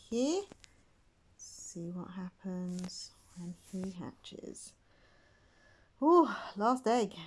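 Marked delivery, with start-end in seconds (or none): 1.4-4.7 slow, soft whispered aside; slow hushed whisper before the reveal. Real, not acted. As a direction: breathe it in suspense. Last egg suspense.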